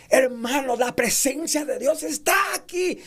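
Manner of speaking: muy agudo y emocionado; proclámalo con entusiasmo de predicador; emoción. Shown as a demonstration. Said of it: "Y subimos el tono de la voz". (anuncio emocionado, tono muy alto)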